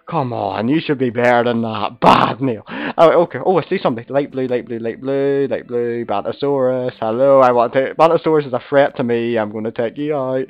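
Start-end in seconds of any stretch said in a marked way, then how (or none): none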